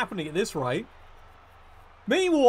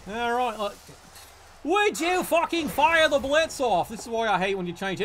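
usual speaking voice

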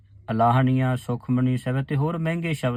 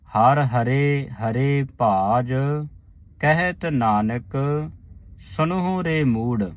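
ਹਰ ਹਰੇ ਹਰੇ ਭਾਜ said slowly with rests; slow recitation; 2 semitones higher than usual. Shown as a demonstration. Teacher reciting the long line slowly for the sangat. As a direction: chant slowly, pausing at ਭਾਜ and Nanak. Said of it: "ਮੂੜ ਤੇ ਵਿਸਰਾਮ ਲਾਉਣਾ ਹੈ".

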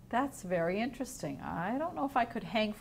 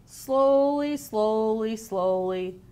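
drawn-out story refrain; 5 dB louder, slower than usual. A narrator's reading. Teacher reading the sloth book to children, stepping down the refrain.

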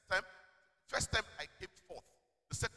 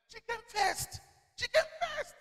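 very high, loud repeated cry; high-pitched exclaiming; 13 semitones higher, 7 dB louder, slower than usual. Real, not acted. Preacher recalling a classmate's win, high and loud exclaiming. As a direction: pitch leaps up, higher on the repeat.

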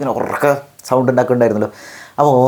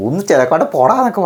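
higher-pitched, annoyed dismissal; irritated brush-off; 7 semitones higher than usual. Acted. Speaker with a headache to someone pestering them, irritated brush-off. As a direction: act out telling a pest to get lost. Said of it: "വല്ലാണ്ട് ചൊറിഞ്ഞിട്ടുണ്ടെങ്കിൽ ഞാൻ ചിലപ്പോൾ".

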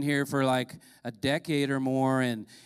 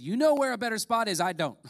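higher, louder challenge to God; mock-indignant prayer; insistent plea; 5 semitones higher, 4 dB louder than usual. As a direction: push back at God, starting high.

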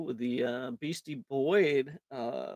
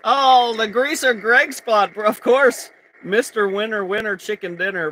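loud amused exclamation; laughing excitement; excited; 5 semitones higher, 9 dB louder than usual. Real, not acted. Reacting to wheel result.

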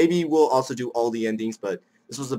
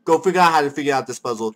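ordinary talk, nothing marked

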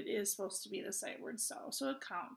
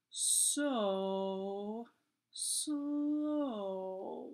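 "so... slow", each word spread out into one long vowel; drawn-out words. Shown as a demonstration. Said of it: "it's spread out, so you're supposed to say it".

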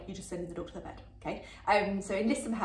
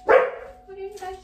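loud greeting; 11 semitones higher, 11 dB louder, slower than usual. Real, not acted. Reacting at the door.

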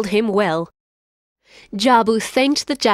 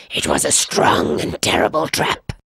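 low lion voice. Acted. Lion insists.

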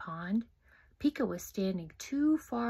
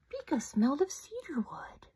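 ordinary delivery

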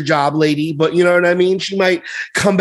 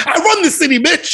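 high, boastful mayor imitation; Eric Adams; 13 semitones higher than usual. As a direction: crow it as a mock mayor, close to laughing. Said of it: "He said something like that".

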